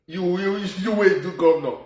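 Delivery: ordinary talk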